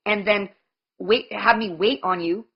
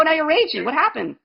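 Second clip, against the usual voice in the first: normal talking voice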